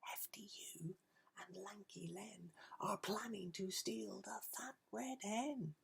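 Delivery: soft urgent whisper; the ladybird whispers the secret; whispered warning. Acted. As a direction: ladybird whispers the plot to the animals. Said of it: "told the animals what she'd heard".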